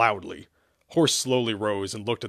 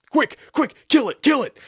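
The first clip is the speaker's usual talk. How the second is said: very high, loud shrieks; frantic screaming; 10 dB louder than usual. A narrator's reading. Knights attack a flung cat, screaming. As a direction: shriek urgent orders in short bursts. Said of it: "they each screamed".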